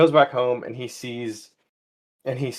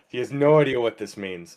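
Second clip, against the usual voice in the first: normal talking voice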